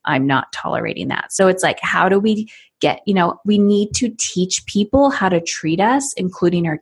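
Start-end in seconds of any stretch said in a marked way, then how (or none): 0.1-1.3 a hint of a stern mom laying down the law; firm mom voice. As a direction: set the boundary calmly. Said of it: "And he gets my".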